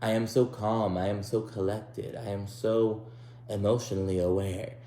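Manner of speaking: soft, measured self-praise; mock serene composure. Mimicking Sarah's self-image before she gets upset, mock calm. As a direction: parody someone proudly calm and collected. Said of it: "what happened to this".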